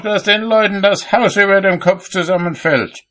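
high-pitched indignant carping; calling out. A narrator's reading. Cobbler finds fault with builders from his window, high indignant call. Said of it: "rief er".

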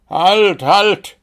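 high, loud shouts of halt; bellowing over music. A narrator's reading. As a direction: shout to be heard over the noise. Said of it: "versuchte Wuschel dagegen anzubrüllen". (laut dagegen angebrüllt)

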